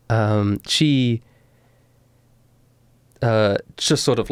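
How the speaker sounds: ordinary delivery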